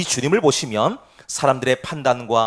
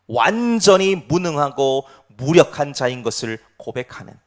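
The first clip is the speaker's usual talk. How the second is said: usual speaking voice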